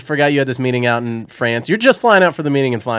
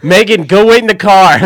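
put-on raised, loud command to a girlfriend; angry order; 4 semitones higher, 8 dB louder than usual. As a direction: send her off in the heat of an argument. Dave orders girlfriend away mid-argument.

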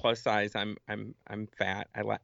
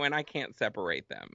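ordinary talk, nothing marked

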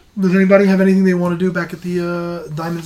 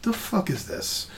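puzzled disbelief; 5 semitones higher, 9 dB quieter, slower than usual. Acted. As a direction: puzzle it out under your breath. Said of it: "It's more like".

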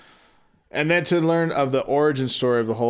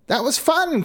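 put-on high, excited child's voice; excited little girl; 12 semitones higher than usual. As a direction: squeal it like a happy kid. Gretel cries out happily.

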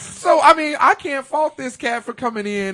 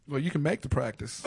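quiet deadpan quip; 6 semitones lower, 8 dB quieter than usual. Retold or imitated. Mimics [name]'s quip.

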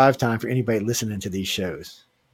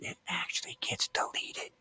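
whispered, slow, like a secret; mock whisper; 12 dB quieter than usual. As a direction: lean in and whisper it slowly. Secret joke about deleting files.

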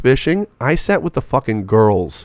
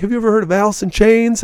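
mock desperate plea; 11 semitones higher than usual. Retold question to party kids.

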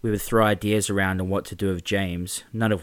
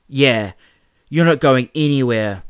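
loud, higher gloating echo; laughing jeer; 5 semitones higher, 9 dB louder than usual. Acted. Carl echoing James at Mark, loud gloating jeer. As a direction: jeer it like a sidekick. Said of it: "Carl laughed".

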